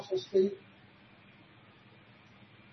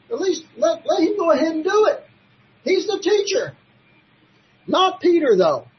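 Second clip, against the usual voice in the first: higher and louder, building fast; rising fervour; 8 semitones higher, 6 dB louder than usual. Real, not acted. Preacher urging the congregation, higher and louder. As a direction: press the point with rising heat.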